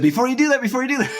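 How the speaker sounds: high, hurried call to wait; a rushed high-pitched warning to hold on; urgent plea. Retold or imitated. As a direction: pitch it way up and urgently stop him. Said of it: "And you said".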